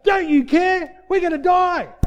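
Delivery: high, loud, drawn-out cry of alarm; panicked shout. Acted. Disciples wake him in the boat, panicked shouting. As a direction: yell it in acted desperation to wake him.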